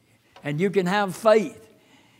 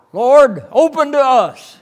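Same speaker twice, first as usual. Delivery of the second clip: high, loud desperate plea at a shut door; pleading cry; 7 semitones higher, 11 dB louder than usual. Acted. Foolish virgins begging the Lord at the door, loud pleading. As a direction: cry out to be let in.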